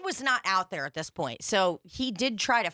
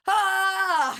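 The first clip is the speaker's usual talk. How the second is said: high, loud mock cry of protest; imitated yelp; 12 semitones higher, 7 dB louder than usual. Co-host imitating the dragged-out fan, high mock yelp. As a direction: let out a hammed-up yelp.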